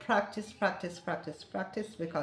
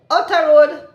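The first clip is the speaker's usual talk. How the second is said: high, loud Jamaican "Outer road"; lively sign-off; 7 semitones higher, 13 dB louder than usual. Shown as a demonstration. Signing off to viewers, loud cheerful patois. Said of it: "we're gonna go outer road now".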